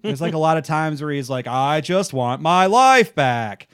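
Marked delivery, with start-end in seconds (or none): 1.4-3.7 slow, drawn-out mock newscaster delivery; hammed-up, loud broadcast voice; newscaster impression. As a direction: overplay the anchor's dramatic emphasis. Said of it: "just sounding like a newscaster".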